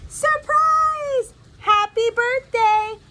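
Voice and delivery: high, loud party cheer; yelling surprise. Acted. Family surprising Lily Laceby on her birthday, joyful yelling. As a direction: burst out with a drawn-out birthday shout. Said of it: "they're yelling".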